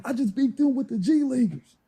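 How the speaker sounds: high, incredulous mock taunt; imitating a taunt. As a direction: act out a winner rubbing it in. Jimmy taunting starters, retold.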